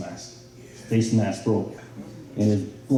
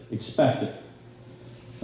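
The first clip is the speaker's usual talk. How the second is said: "Expect it." whispered very slowly and softly; whispered emphasis; 7 dB quieter, much slower than usual. Shown as a demonstration. Speaker driving home the coach's lesson, slow whispered emphasis.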